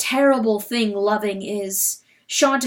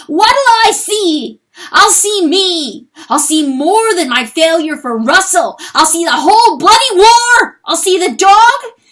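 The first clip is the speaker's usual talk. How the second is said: anguished scream; 8 semitones higher, 12 dB louder than usual. Acted. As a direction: hurl each line out in a rising panic. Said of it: "He screamed".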